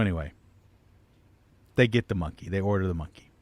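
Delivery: ordinary delivery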